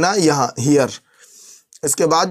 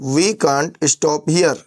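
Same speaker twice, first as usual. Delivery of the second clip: very slow, every word spelled out; slow clear gloss; much slower than usual. Shown as a demonstration. Teacher glossing for students, very slow and deliberate. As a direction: say it slowly for learners. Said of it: "teacher glosses phrase for learners".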